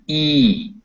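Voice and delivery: "E" held as in read, tongue high and front; long ee vowel. Shown as a demonstration. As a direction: draw out a pure "E" as in beat. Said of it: "Your tongue goes way up".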